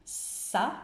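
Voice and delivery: "sa" with a drawn-out hissing s; isolated syllable. Shown as a demonstration. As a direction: hold the s before releasing "sa". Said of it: "Cette première syllabe". (« sa » détaché, s initial prolongé)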